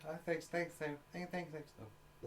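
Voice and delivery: high, soft string of thanks; meek mock thanks. Passerby escaping a stranger, meek mock politeness trailing away. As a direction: back away with small, over-polite thanks.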